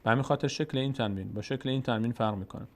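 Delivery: ordinary delivery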